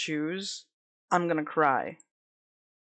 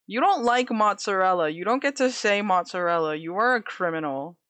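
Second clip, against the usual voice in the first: ordinary talk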